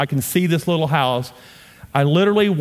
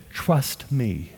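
soft divine answer; 5 semitones lower, 6 dB quieter than usual. Retold or imitated. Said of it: "And he said".

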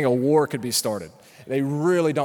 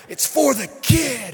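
earnest stranger; 8 semitones higher, 4 dB louder than usual. Retold or imitated. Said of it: "I kid you not".

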